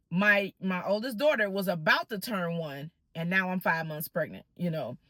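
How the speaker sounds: ordinary delivery, nothing marked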